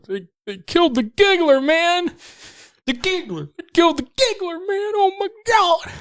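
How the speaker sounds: high, wailing distraught thug; wailing gang member. Retold or imitated. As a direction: wail it in high despair. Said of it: "They're just like".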